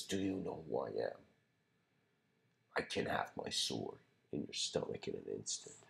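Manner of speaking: low, hushed, menacing warlord; hateful threat. Retold or imitated. Warlord threatening the monk with his sword, hushed hatred. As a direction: sink into a near-whisper of quiet rage. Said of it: "With, like, hatred".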